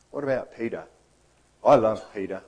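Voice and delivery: ordinary talk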